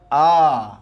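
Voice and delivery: আ called out loud; teacher models the vowel আ; demo vowel a. Shown as a demonstration. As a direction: sound আ out clearly. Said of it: "আছে হচ্ছে".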